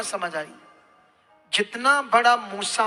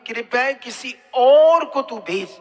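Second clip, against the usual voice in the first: drawn-out, louder stress on और; acted plea, और held long; pleading with God; 5 dB louder, slower than usual. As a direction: plead slowly, stretching और out loud. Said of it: "और उसने कहा, हे मेरे प्रभु".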